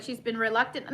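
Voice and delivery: ordinary delivery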